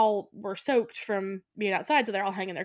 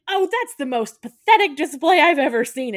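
higher, louder contempt; disgusted scorn; 7 semitones higher, 10 dB louder than usual. Retold or imitated. Husband mocks wife's display, loud high scorn. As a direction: sneer it out in disbelief. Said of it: "he's like".

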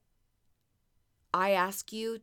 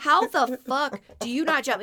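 exasperated question; 6 semitones higher, 6 dB louder than usual. Retold or imitated. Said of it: "asked my husband".

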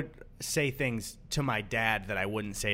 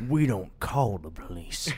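low, slow backwoods drawl; 4 semitones lower, slower than usual. Retold or imitated. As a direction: drawl it like a hillbilly. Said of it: "redneck sign quote".

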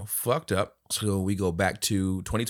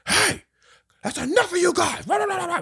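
ref yelling. Retold or imitated. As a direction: bark it like a petty ref. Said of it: "There's no".

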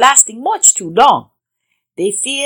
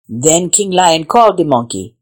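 usual speaking voice

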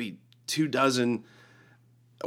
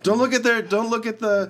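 high, raised acted appeal; urgent pleading; 10 semitones higher, 3 dB louder than usual. Jesus pleading with Father for sinners, urgent pleading. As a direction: plead on their behalf, pitched up.

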